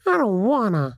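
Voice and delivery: high, whiny refusal; put-on petulant whining; petulant whine. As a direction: whine it like a sulky little creature. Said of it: "kinda petulant and whiny".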